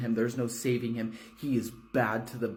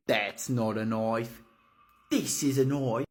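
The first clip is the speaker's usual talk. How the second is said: put-on Australian accent. Movie quote, knife pronounced noif.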